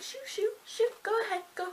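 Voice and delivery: high-pitched, lightly acted shooing; imitated hurried send-off; mock shooing. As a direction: shoo someone off in a high voice. Imitated daughter shoos mom away.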